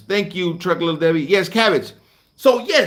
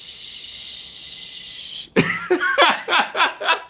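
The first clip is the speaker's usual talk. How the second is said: breathy laugh; 11 semitones higher than usual. Real, not acted. Still laughing at the story.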